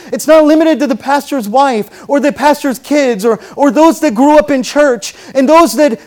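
loud, high, impassioned sermon; full-force preaching. Real, not acted. Preacher assuring congregation anybody can be saved, loud and impassioned. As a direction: drive each phrase home at full voice.

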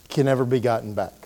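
ordinary delivery

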